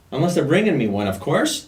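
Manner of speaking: teasing joke. Acted. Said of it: "No, I'm just kidding".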